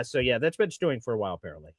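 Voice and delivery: usual speaking voice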